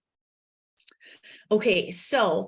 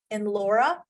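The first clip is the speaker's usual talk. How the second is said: ordinary delivery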